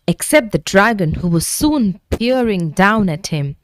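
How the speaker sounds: ordinary delivery, nothing marked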